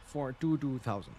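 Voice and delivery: ordinary talk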